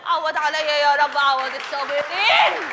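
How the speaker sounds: ordinary delivery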